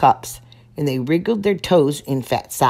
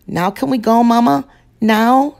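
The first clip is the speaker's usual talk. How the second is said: higher and louder, bouncing with impatience; eager pleading; 8 semitones higher, 7 dB louder than usual. Acted. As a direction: beg mama to leave right now. Impatient cub begging mama.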